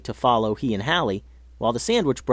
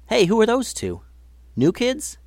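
curious call; 10 semitones higher than usual. Acted. Hallie spots new kids.

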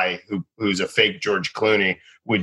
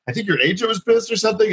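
higher, quicker mock-innocent remark; faux innocent; 11 semitones higher, faster than usual. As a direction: play dumb, lightly. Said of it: "I was like".

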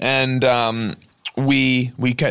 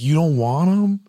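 mildly hurt, trying to put on a brave question; sad but brave; 3 semitones higher than usual. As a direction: imitate a wounded counter worker, lightly. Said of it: "kind of voice? they're sad".

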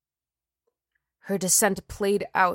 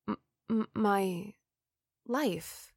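faltering; 6 dB quieter than usual. Acted. She falters, realizing.

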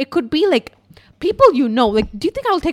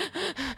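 soft, high imitated crying gasps; sniffles; 7 semitones higher, 12 dB quieter than usual. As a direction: mimic quick sniffly sobs. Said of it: "Like, sniffles".

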